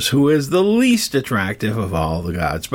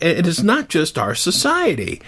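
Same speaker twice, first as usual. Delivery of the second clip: ordinary delivery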